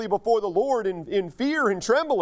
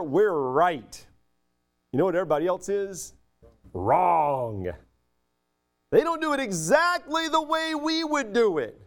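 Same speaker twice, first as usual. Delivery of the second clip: smug mockery. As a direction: play the proud know-it-all sneering at everyone else. Mocking the proud church crowd.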